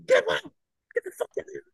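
high, strained mock scream; hammed-up high-pitched yelling; imitated screaming corporal. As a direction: mimic a superior losing his mind. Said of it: "loses his fucking mind on me".

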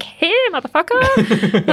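high, drawn-out mock outrage; mock indignant comeback. Local answering the Real Housewife, mock indignant. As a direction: stretch it out high, hammed-up.

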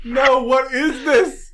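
high, slow, disbelieving cry; incredulous. Real, not acted. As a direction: react to something absurd. Shocked at Glacier's entrance.

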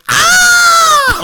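high-pitched piercing shriek, like a woman; shrill scream. Retold or imitated. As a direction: scream high and loud in sudden panic. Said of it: "And I screamed".

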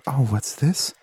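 usual speaking voice

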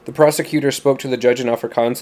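plain reading voice

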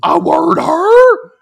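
very loud, high, drawn-out shout; hammed-up sergeant bellow, stretched out; drill sergeant command. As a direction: holler a marching order at full blast. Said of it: "turns on command".

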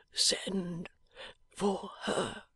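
barely voiced, dying breath; a dying man's faint whisper. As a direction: whisper it weakly from a sickbed.

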